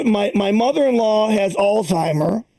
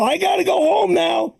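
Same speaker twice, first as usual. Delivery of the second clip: insistent mother-in-law; 8 semitones higher than usual. Retold or imitated. Comic imitating his mother-in-law as she gets up, insistent and higher. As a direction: push it up, she means it this time.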